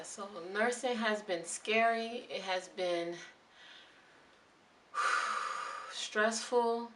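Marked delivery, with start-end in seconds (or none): 4.9-5.9 long, soft breathy sigh; stressed sigh. Real, not acted. Nurse recalling how stressful nursing has been, heavy sigh. Sighs before saying stressful.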